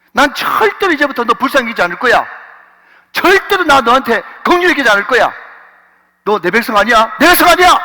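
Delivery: loud, rising anger to a high cry; stern divine rebuke. Acted. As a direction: thunder the rejection, climbing. God voiced rejecting people. (점점 높아지는 큰 분노의 외침)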